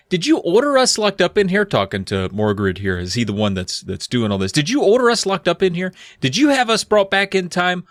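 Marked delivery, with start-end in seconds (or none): 0.1-1.6 accusing question. Acted. Child confronts sorcerer in read dialogue. 4.5-7.8 put-on high, urgent child's demand; accusing questions. Child confronts sorcerer in read dialogue.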